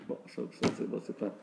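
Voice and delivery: half-swallowed aside under the breath; whispered aside. Real, not acted. Teacher whispers an aside to himself mid-lesson. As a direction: drop to a breathy whisper, to yourself.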